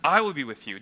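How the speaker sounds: "I" hit hard at the start, the rest falling away; stress on I. Shown as a demonstration. Preacher re-reading God's promise to Moses, stress on "I". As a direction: punch the word "I". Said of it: "Notice the emphasis".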